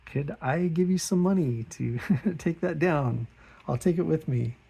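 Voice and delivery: high, embarrassed laughing politeness; awkward laughing. Retold or imitated. Artist embarrassed by his old drawing on the door, laughing awkwardly. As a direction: laugh it off while squirming with embarrassment. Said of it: "And I just kinda".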